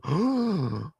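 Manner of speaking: mock horrified gasp; acted sharp intake of breath; shocked gasp. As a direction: gasp as if shocked by a search result. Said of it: "they're gonna be like".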